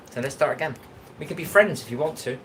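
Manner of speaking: ordinary talk, nothing marked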